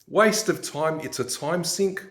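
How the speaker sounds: ordinary delivery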